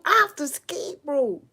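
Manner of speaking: high, loud panicked cry. Real, not acted. Gamer fleeing a snake, high panicked cry. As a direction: scramble away in real alarm.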